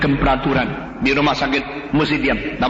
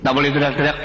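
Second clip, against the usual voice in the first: ordinary talk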